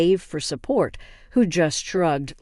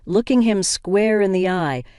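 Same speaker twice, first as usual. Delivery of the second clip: plain reading voice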